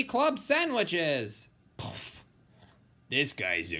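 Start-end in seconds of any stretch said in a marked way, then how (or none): none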